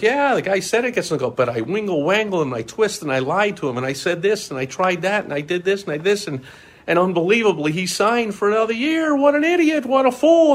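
builds to a high, loud crow; gloating brag. Retold or imitated. Sales rep bragging to the office: loud gloating crow.

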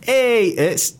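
high, loud mock friendly shout; hammed-up high over-friendly greeting; mocking a friend's eager hello to a neighbour. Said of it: "James is going".

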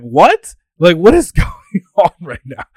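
loud, high, laughing disbelief; incredulous reaction. Retold or imitated. Guest hears the dad's song request, incredulous. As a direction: act baffled, breaking into a laugh. Acting out his own reaction.

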